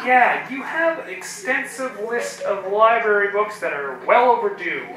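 ordinary talk

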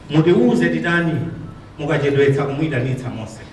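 declaimed stage poem, lines falling at the end; poem recitation. Acted.

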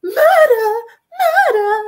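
very high, loud theatrical shriek. A narrator's reading. Lady Weatherby as her son is attacked, theatrical shrieks. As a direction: scream it out in hammed-up alarm. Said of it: "Lady Weatherby's shrieks of".

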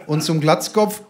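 usual speaking voice

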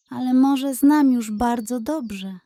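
usual speaking voice

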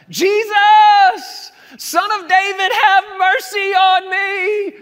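high, loud desperate shout; a beggar's pleading yell at full volume; crying out loud. Retold or imitated. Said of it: "Crying out".

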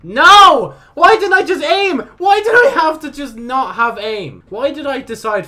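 high, loud wail easing slightly; exasperated screaming. Real, not acted. Player berating himself for not aiming, high frustrated screaming. Berates self after losing fight.

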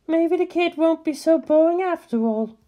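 lightly voiced Misato, thinking aloud; a hint of amused musing. A narrator's reading. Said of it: "the nerve officer mused".

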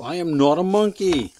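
indignant hammed-up denial; loud mock protest. Protesting against the book.

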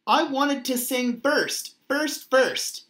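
a restrained yell, stressing each repeat; mild story yell. A narrator's reading. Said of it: "yelled Mei Mei".

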